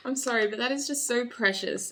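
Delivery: usual speaking voice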